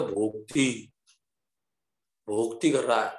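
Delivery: put-on Bengali accent, low and rounded vowels; bengali accent in hindi. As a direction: Bengali babu saying भोक्ति for भक्ति. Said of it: "Bengali बाबू बोलते हैं".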